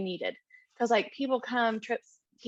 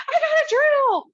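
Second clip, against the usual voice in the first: excited shout; 10 dB louder than usual. Retold or imitated. Ruben excitedly shouts to Matt.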